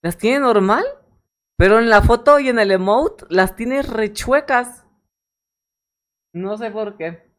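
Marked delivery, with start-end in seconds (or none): 0.0-4.8 excited. Real, not acted. Complaining about the merch's eyes.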